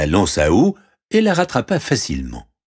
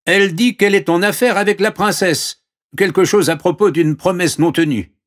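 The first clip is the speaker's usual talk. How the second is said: high servant voice; servant reporting; 12 semitones higher, 4 dB louder than usual. Acted. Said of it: "répondit le serviteur". (voix aiguë de serviteur)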